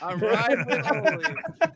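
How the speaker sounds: gleeful laughing declaration; laughing excitedly. Real, not acted. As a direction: laugh through it, all in on Ole.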